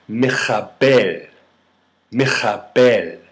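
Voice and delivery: "Michabel" with a throaty Hebrew kh; guttural Hebrew sound. Shown as a demonstration. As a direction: say "Michabel" slowly, scraping the kh in the throat. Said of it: "which includes a guttural".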